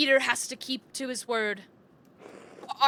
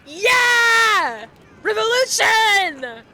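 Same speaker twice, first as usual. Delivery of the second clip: mob shout; 7 semitones higher, 7 dB louder, much slower than usual. Acted. Angry mob cheering revolt.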